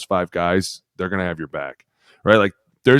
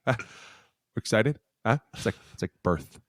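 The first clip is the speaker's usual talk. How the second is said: Jim Harbaugh; 2 semitones higher, 3 dB quieter than usual. Retold or imitated. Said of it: "this is him".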